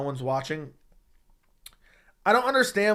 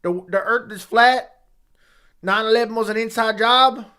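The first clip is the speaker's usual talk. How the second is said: country accent; 6 semitones higher, 5 dB louder than usual. Shown as a demonstration. Streamer mimicking a country conspiracy believer, country accent. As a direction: declare it in a put-on country accent. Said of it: "That's the best I got for country accent".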